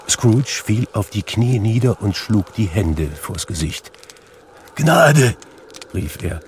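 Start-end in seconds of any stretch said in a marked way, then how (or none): 4.8-5.4 high, loud cry for mercy; frightened, raised-voice begging; terrified plea. A narrator's reading. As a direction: cry out in dread. Scrooge begs the terrible apparition.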